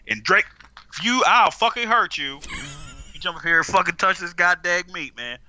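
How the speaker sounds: loud, threatening shout at the cat; angry yelling. Real, not acted.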